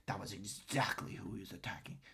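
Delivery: hushed, whispered mimicry; soft, voiceless parody of a pundit; mocking rhetoric. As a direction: whisper it in mock dramatic emphasis. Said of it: "I hate this stupid rhetoric".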